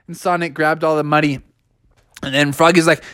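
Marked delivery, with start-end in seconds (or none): none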